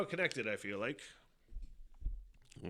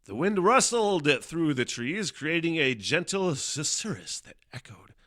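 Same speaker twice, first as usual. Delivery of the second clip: mock dramatic recital; overdramatic reading; 5 semitones higher, 7 dB louder than usual. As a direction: read it like an epic movie trailer. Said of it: "I shouldn't read it like that".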